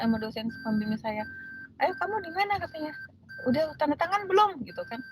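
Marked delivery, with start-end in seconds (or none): 1.8-2.6 high-pitched questioning voice; voiced supervisor. Supervisor asking student's whereabouts, high imitated questioning. 3.5-4.6 high, imitated checking-up question; voiced supervisor. Supervisor checking on student's signature, high imitated questioning.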